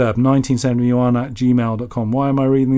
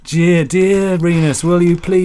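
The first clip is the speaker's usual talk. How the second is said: slow, higher-pitched TV presenter send-up; Jimmy Savile; 5 semitones higher, 4 dB louder, slower than usual. Retold or imitated. Host reading a listener's letter, hammed-up presenter voice. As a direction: draw the words out in a mock presenter voice. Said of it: "Jimmy Savile".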